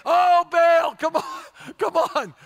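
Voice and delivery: high, loud mock prayer; hammed-up cry begging for an answer; mock pleading shout. Prophets begging Baal to respond, mock pleading shout. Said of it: "You know what they're saying?".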